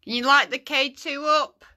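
loud, high request; calling out. Real, not acted. Ghost hunter asking spirits to light the device, calling out. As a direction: project a question into an empty house.

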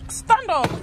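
high, sharp order at the dog; sharp command. Real, not acted. Telling the dog to get up, sharp high command. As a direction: snap the dog to its feet.

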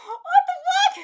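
high, loud, laughing exclamation; real incredulous squeal; laughing disbelief. Real, not acted. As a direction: blurt it out through laughter. Reacting to someone being buried.

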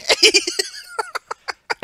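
real laughter, very high-pitched; high squealing laugh. Real, not acted. Hosts crack up at retelling.

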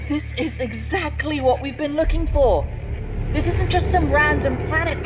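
high, loud, elated rush; high-pitched excitement; excited discovery. Acted. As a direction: burst out with the big realization. Thrilled at discovering the key.

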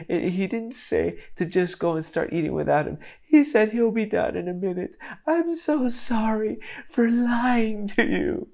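a sorry little daughter, close to sobbing; tearful little girl. Retold or imitated. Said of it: "And Lily goes".

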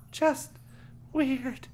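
high, hesitant character voice; girl feeling strange. A narrator's reading. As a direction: answer uneasily with a pause between words. Voices Aaron in the story.

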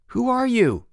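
high, carrying call. A narrator's reading. Boots to the stranger in the basket, calling. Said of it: "he called".

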